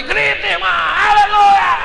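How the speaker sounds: high, loud, stretched-out hallelujah; joyful shout. Retold or imitated. Preacher urges the sister on, loud high stretched shout. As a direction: cheer the sister on at full voice. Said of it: "eu fechei meus olhos e falei".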